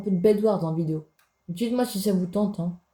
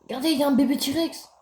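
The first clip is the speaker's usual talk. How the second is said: high, excited call to the viewers; 8 semitones higher, 3 dB louder than usual. Real, not acted. Gamer showing viewers a baby T-Rex, excited. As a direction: cry out with delight at a discovery.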